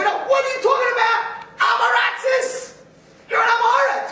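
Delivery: high, loud mock yelling at a study partner; yeshiva shouting match. Teenage yeshiva boys yelling at each other over Rashi, loud. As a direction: yell like you're winning the argument. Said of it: "yelling, screaming".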